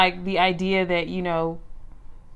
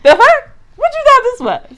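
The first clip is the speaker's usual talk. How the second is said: mock outrage; 11 dB louder than usual.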